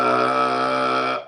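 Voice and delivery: long, low, dumbstruck "uh"; drawn-out low sound of being lost for words; starstruck stammer. Retold or imitated. Starstruck actor meeting his director, dumbstruck.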